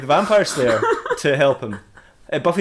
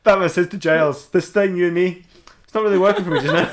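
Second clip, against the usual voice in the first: laughing; 6 semitones higher than usual. Real, not acted. Laughing while telling Batman line.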